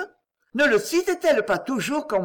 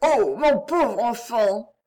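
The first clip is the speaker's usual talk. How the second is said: put-on slow, grieving lament over the poor boy; a mother pitying her daughter's suitor, slow and desolate; desolate sigh; 2 semitones higher, slower than usual. A narrator's reading. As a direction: let the words sink with sorrow, drawn out. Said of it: "dire avec désolation".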